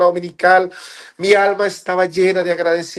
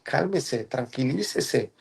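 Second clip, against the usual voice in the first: quiet, slow and soothing; soft calming inner voice; 3 semitones lower, 10 dB quieter, much slower than usual. Retold or imitated. An inner voice calms the angry husband, soft and slow. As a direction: hush him like a gentle inner voice.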